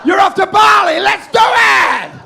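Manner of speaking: high, loud, drawn-out mock cheer; manic shout. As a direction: yell it like a crazed impulse. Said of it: "intrusive thought while bathing kids".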